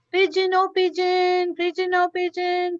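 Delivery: sing-song call to a bird far away. Acted. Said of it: "Prophet Ibrahim called".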